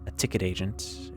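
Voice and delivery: plain reading voice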